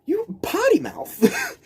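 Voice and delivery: very high, slow prissy gasp; squeaky scandalized granny voice; mock scandalized lady. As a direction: clutch pearls at her swearing. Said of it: "And I'm like".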